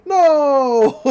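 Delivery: loud, high, drawn-out "no"; hammed-up wail of belated panic; acted horror. Pig realises he is the bacon, mock-horrified wail.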